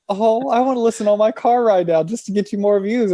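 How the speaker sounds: a friend keen to help; eager friend. Retold or imitated. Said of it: "they're like".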